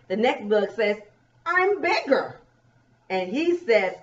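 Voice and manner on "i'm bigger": high-pitched bug voice; one-up the other bug for little listeners; lifted storybook brag; boasting bug. Acted. Reads the bug's line.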